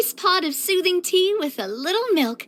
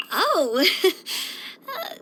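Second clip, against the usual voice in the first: awkward laugh; 3 semitones higher than usual. Acted. Reacting to failed cooking attempt.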